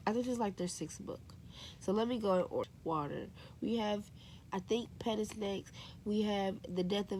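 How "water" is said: ordinary talk, nothing marked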